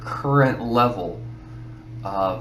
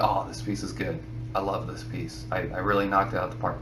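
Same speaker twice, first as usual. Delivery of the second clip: low, much softer, quick self-congratulating murmur; softer, fast mock self-admiration; smug self-praise; 7 semitones lower, 9 dB quieter than usual. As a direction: mutter your own praise under your breath. Voicing a self-satisfied artist.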